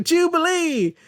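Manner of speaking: high, delighted exclamation easing into chatter; high-pitched, gleeful greeting; happy Rogue. Acted. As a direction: cry out the name in high, happy relief. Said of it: "Rogue is happy and she's like".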